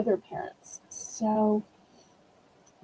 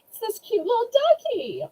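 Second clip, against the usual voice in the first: put-on baby talk; 11 semitones higher than usual. Showing a toy duck to buyers.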